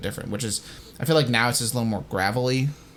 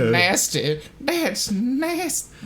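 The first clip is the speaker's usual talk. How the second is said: disgusted drawl; 11 semitones higher, much slower than usual. Shown as a demonstration. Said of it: "they got the clean voice".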